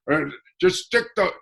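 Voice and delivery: imitating dad's Boston accent. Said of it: "And he talked like this".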